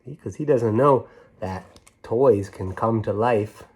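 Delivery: ordinary delivery, nothing marked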